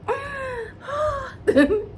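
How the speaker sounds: high squealing giggle through tears; high teary laugh. Real, not acted. Gushing about Lauren's luck, a teary giggle. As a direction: laugh like you're overwhelmed.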